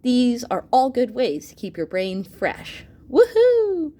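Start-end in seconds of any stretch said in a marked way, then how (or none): 3.1-3.9 high, loud woohoo; cheer. Acted. Cheering young readers on, loud excited whoop. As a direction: whoop for joy at the kids' progress.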